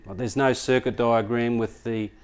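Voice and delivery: normal talking voice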